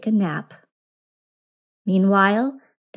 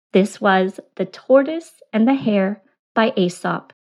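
usual speaking voice

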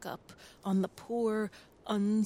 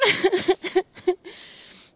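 laugh; 12 semitones higher, 7 dB louder than usual. Real, not acted. Laugh after rant.

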